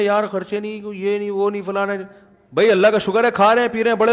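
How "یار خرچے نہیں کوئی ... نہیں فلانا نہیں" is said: softer, level-pitched imitation of money worries; mock tense grumbling. Father of many mimics the money worries he never shows, mock tense grumbling. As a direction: list the worries under your breath, mockingly. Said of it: "کوئی دیکھا tension میں".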